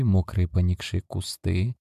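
usual speaking voice

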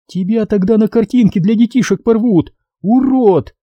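высоко и зло рыкнуть; огрызнуться со злостью; рыкнула. A narrator's reading. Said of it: "рыкнула барышня". (Надя рычит на красноармейца)

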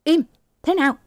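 high-pitched mild shout; strained yell. Acted. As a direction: try to shout them into silence. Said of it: "Thừa cố thét ra tiếng".

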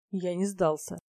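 usual speaking voice

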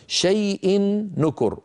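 slow recitation, high, merging the nun into a doubled nun; recited tajweed example. Shown as a demonstration. Teacher to students reciting full idgham. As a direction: recite شيء نكر slowly with full idgham. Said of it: "انظروا إلى الأمثلة".

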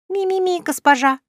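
high squeaky chick voices; chicks squeaking. Acted. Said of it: "Хором пропищала пернатая семейка".

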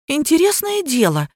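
high-pitched indignant protest. A narrator's reading. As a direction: protest at once, pitched well up. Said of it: "немедленно возмутилась я".

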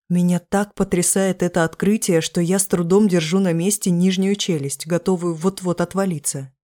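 ordinary delivery, nothing marked

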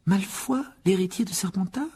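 high, questioning disbelief; sceptical murmur. Acted. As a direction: ask doubtfully, rising at the end. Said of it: "murmura Hermione d'un ton sceptique". (murmure sceptique, qui monte dans l'aigu)

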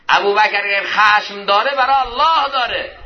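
impassioned preaching. Acted. Preacher explaining anger for God's sake, impassioned. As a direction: proclaim it high and firmly to the congregation.

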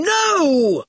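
high, drawn-out outraged cry; emphatic refusal. A narrator's reading. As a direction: howl the refusal. Quentin refuses again, stronger.

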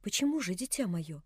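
put-on soft, hushed old man holding back tears; tender sad old man. A narrator's reading. Old man gently asking the orphan girl, near tears. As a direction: gently plead through tears. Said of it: "накипела слеза".

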